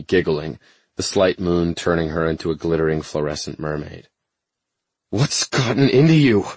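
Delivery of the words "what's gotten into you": loud, high scolding shout; angry shout. Acted. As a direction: demand it in anger. He is furious at her.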